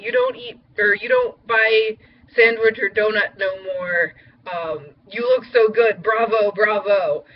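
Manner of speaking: playful Spanish-accented impression of the coffee lady. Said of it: "in this little Spanish accent".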